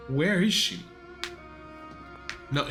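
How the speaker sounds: normal talking voice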